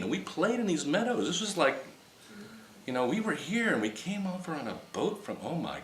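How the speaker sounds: high, breathless wonder; excited recollection. Retold or imitated. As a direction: spill the memory out in amazement. Excitedly recalling a past-life vision.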